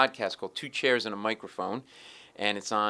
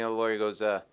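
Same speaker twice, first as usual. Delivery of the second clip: ordinary talk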